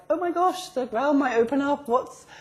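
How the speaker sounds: high-pitched mock alarm; retold panic. Speaker reliving her embassy moment, mock panicked. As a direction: gasp it out high, as if mortified. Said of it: "that moment where you're like".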